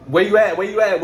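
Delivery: angry call asking where the car is; urgent demand. Retold or imitated. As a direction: bark it down the phone at him.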